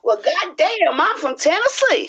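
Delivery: Tennessee twang on every word; Tennessee accent. Pretend. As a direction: put on a Southern Tennessee accent. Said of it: "give me your best Tennessee accent".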